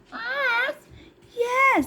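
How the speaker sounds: high puppet yes. Acted. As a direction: answer high as the little puppet. Puppet Ernie agrees.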